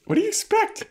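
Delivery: very high put-on squawk; a cartoonish scolding squeal; mock yell as Spike. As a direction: throw it up high, exasperated. Voicing Spike yelling.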